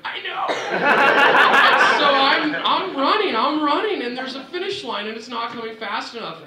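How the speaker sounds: very high, loud, laughing cries; overjoyed laughing. Real, not acted. Racer spotting his coach near the line, laughing and high. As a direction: let the joy burst out at the finish. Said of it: "so shocked and freaked out".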